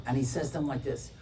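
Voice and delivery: ordinary delivery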